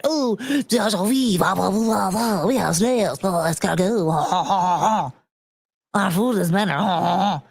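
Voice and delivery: lightly voiced smug French braggart, laughing; a hint of a haughty Frenchman cackling; mock French snob. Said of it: "they said".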